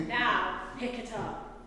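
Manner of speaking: loud, slow order; commanding. A narrator's reading. God orders Moses to pick up the snake, loud and commanding. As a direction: give a firm, deliberate command. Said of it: "God commanded".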